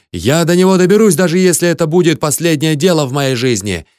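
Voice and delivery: high, furious ranting threats; heated, high-pitched vow of revenge; defiant shouting. A narrator's reading. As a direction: roar defiance at the whole crowd. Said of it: "кричал я".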